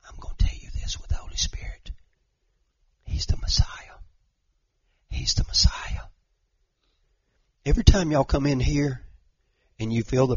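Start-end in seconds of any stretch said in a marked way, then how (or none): 0.1-6.1 breathy hush, as if confiding a holy truth; hushed secret whisper. Acted. Jesus quote told in secret.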